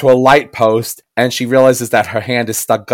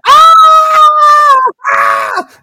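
loud yelps; startled shout; 8 dB louder than usual. Retold or imitated. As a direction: yelp in alarm. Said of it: "And she's like".

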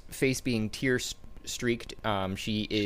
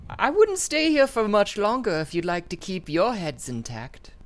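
put-on creepy little girl; 12 semitones higher, 5 dB louder than usual. GM voices creepy demon girl.

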